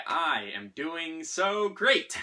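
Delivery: slow, overstated cheer building at the end; put-on positivity; fake enthusiasm. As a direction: insist on being great, drawn out. Acted fake answer hiding feeling awful.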